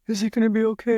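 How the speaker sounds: put-on anxious kid asking if he'll be fine; panicked child. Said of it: "he starts to go into this panic mode".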